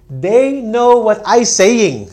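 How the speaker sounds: loud, high, slow student insisting; mock student voice. Student defending bad English to the teacher, mock high insistence. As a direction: mimic a stubborn student. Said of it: "That's bad English".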